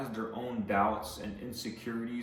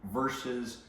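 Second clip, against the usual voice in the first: normal talking voice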